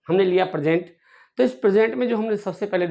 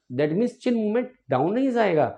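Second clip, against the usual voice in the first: ordinary talk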